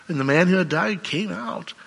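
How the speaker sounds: ordinary talk, nothing marked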